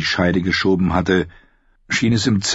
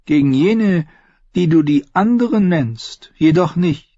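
knowing red priestess; 9 semitones higher than usual. Acted. Red woman explains frozen fire, high and assured. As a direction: speak high, like a seeress explaining lore. Voices Melisandre.

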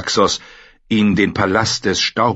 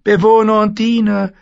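very high, loud, eerie warlock voice; a thin, uncanny sorcerer; 5 dB louder than usual. Acted. The warlock Pyat Pree bars the way, high and eerie.

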